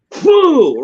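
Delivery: high, sudden "phew" of spitting; spitting out food. Retold or imitated. Girlfriend spits out the salty ham, mock spit. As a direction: imitate spitting out a bite, pitched high.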